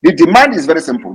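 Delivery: normal talking voice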